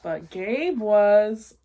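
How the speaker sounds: slow, loud ominous reveal; drawn-out spooky storyteller beat; dramatic reveal. A narrator's reading. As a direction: land it slowly like a scare.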